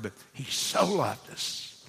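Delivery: soft, slow "he so loved us", "so" and "loved" leaned on; stress on so loved. Shown as a demonstration. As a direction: hush it and weigh "so loved". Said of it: "Not a two-letter word".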